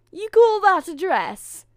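high, very loud, drawn-out screech; scornful scream. A narrator's reading. Stepsister scorning a dress, screaming. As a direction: scream it in slow disbelief. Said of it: "screamed the other".

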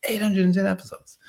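high, marvelling repeat of "eight hundred and ten episodes"; amazed emphasis. Real, not acted. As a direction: punch the number up high in amazement.

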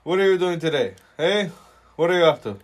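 loud, high, effusive shop boss; boss impression. Imitating the boss taking a potato order, loud high impression. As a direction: pester the customer in a high, booming put-on voice. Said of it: "He's like, "My brother".

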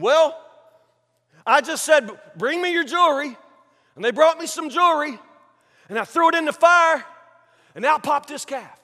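high, loud, lame excuse; Aaron. Acted. Aaron explaining the calf to Moses, mock-innocent. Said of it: "What does Aaron say?".

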